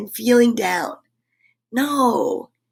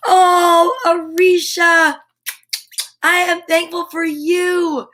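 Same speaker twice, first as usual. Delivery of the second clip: excited greeting; 6 semitones higher, 4 dB louder, slower than usual. Real, not acted. Greets a viewer by name.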